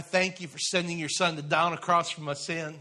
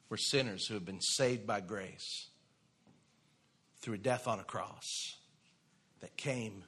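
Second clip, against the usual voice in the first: quiet sermon appeal; 6 semitones lower, 7 dB quieter than usual. Acted. Pastor drops to intimate appeal.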